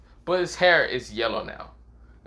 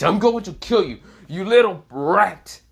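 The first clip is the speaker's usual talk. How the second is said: higher, raised villain threat, slowing at the end; furious threat; 4 semitones higher, 4 dB louder than usual. Acted. Vector back from being smashed, angry threat. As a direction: threaten hard, drawing out the final insult.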